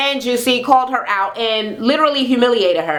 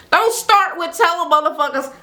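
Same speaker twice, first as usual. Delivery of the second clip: ordinary talk, nothing marked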